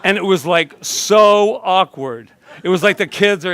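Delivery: cracking up at the awkward memory; laughing. Real, not acted. Minister telling the congregation about the awkward movie scene, laughing loudly.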